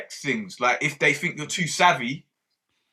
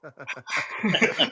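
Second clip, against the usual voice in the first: laugh; 3 semitones lower than usual. Real, not acted. Laughing at friend's joke.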